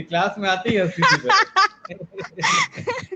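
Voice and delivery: high, slow, laughing through words. Real, not acted. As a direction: laugh while telling it. Recalls her laughing entry.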